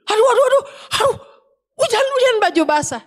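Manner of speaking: high frantic alarm about the rain; alarmed shout. Retold or imitated. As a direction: yell as if disaster struck. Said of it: "salah satu begini".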